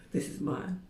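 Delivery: usual speaking voice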